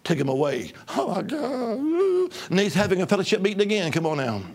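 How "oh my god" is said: drawn-out high wailing cry; mock frightened wail. Preacher retelling the plane arrest, hammed-up frightened wail. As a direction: ham up a terrified cry.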